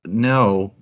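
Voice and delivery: normal talking voice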